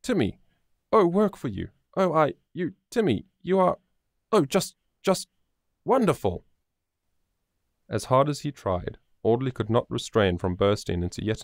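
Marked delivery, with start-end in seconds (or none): 0.0-6.4 much higher, tripping over words with joy; too overjoyed to finish a sentence; joyful jumbled words. A narrator's reading. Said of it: "a confusion of words all jumbled together".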